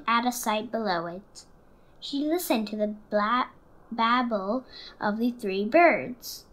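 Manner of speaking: put-on lively storybook narration for children; story reading. A narrator's reading. Reading Stellaluna to the class, warm storytelling voice. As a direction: read it like story time for kids. Reads picture book aloud.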